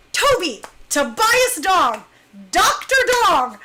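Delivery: loud, high, emphatic name-drop; emphatic introduction. Real, not acted. Excitedly introducing the team's doctor.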